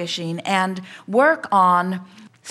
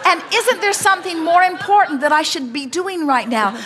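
laughing; 9 semitones higher, 4 dB louder than usual. Real, not acted. Laughing while voicing the questions.

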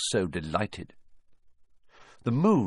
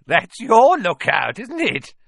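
high, loud, slow gleeful crack; 12 semitones higher, 9 dB louder than usual. Acted. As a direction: cackle the words with nasty delight. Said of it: "his voice cracking with glee".

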